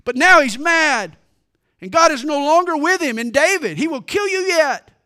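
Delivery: loud, high, furious retort; blazing back. A narrator's reading. Joab blazes back at David, a loud high angry retort. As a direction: fire the warning back at him. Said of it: "Joab blazed back".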